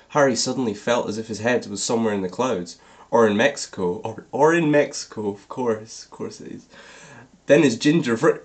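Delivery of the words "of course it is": breathy whispered aside, half to herself. Real, not acted. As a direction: murmur it under your breath, amused.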